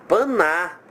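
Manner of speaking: "Paná" as pa-NA, the N joined to the A; unwanted syllable NA. Shown as a demonstration. Teacher picking out the bad syllable, "Paná" with N glued to A. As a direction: isolate the faulty join "Paná". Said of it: "Eu não quero paná".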